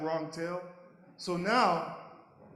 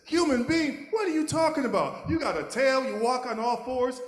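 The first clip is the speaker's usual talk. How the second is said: high, loud scoffing disbelief; mocking friend; 8 semitones higher, 6 dB louder than usual. Best friend ridiculing the puppy's human dream, loud and mocking. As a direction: scoff at the silly idea, pitched up.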